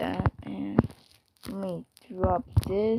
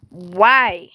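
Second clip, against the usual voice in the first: exasperated; 11 dB louder than usual. Real, not acted. Frustrated at own mistake in game.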